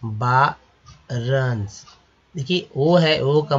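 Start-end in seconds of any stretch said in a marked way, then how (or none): 0.0-1.9 बारंस very slowly, "baa... runs"; syllable-by-syllable demonstration. Shown as a demonstration. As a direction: split बारंस into two stretched syllables. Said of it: "क्या पढ़ेंगे?".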